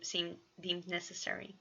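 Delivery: usual speaking voice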